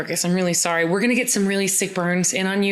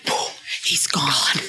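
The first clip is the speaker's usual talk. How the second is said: whisper; 5 semitones lower, much slower than usual. Pretend. Dramatic vanishing.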